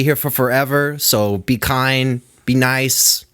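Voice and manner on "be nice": ordinary talk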